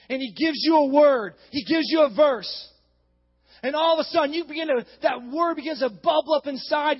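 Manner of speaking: punched, mounting preacher's cadence; fervent preaching. Acted. Preacher declaring a life verse to church, fervent preaching. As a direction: hammer out each phrase with conviction.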